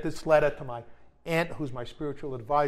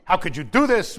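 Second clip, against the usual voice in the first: loud, mock hysterical wife; imitating a frantic ex-wife, louder; 4 semitones higher, 11 dB louder than usual. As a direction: shriek it like the upset ex-wife. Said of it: "hysterical call from my ex-wife".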